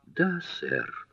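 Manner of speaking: softly squeaked reply; small, thin, meek little voice. A narrator's reading. Maudie answers the guest's question, in a small squeak. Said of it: "пропищала она тоненьким голоском".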